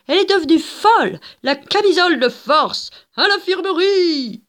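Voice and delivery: voix aiguë et forte de passant paniqué; foule affolée. Acted. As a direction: crier l'alarme, très haut. Foule crie contre Gilda. (passante affolée, voix très aiguë)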